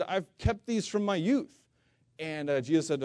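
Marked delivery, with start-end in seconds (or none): 0.0-1.6 higher, slower, a lightly voiced earnest young man; rich young ruler. Preacher voicing the rich young man answering Jesus, high and slow. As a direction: pitch it up and draw it out, a touch self-assured. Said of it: "And the guy said".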